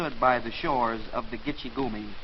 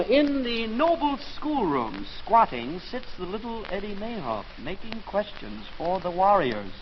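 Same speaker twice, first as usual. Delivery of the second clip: mock Longfellow verse; 4 semitones higher than usual. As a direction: intone it like a parody of an old epic poem. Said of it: "Longfellow's got me doing it".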